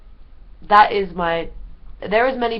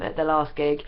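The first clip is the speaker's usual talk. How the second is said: ordinary delivery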